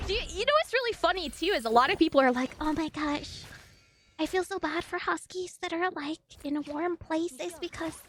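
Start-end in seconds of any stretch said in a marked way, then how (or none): 2.6-8.0 softer, cooing mimicry of concern; mock pity. People feeling sorry for huskies in warm places, mock pity. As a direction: imitate someone fretting over poor animals.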